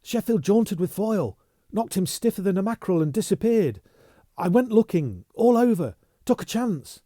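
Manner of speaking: breathless urgency. Acted. Bunny breathless, urgent report.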